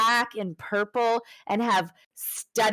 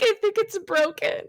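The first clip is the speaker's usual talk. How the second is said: high whiny voice; 12 semitones higher, 3 dB louder than usual. Retold or imitated. Voicing Lily hurt.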